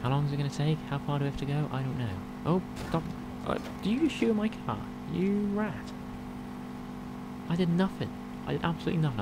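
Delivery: high-pitched exasperated protest; rising indignation at being shot; indignant outburst. Real, not acted. Shot at while driving.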